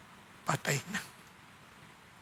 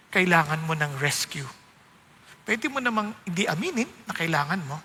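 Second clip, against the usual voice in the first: usual speaking voice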